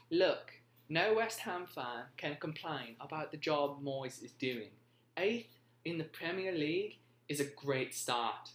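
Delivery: put-on faking a British pundit's accent and cracking up. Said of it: "I cannot do a British accent".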